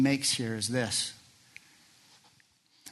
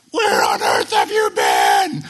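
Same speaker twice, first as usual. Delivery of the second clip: loud, low, slow cry of a frantic parent; frantic parent shout; 13 semitones lower, 12 dB louder, slower than usual. Retold or imitated. Mother shouting at her lost son, loud and frantic. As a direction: bellow it like a panicked mother.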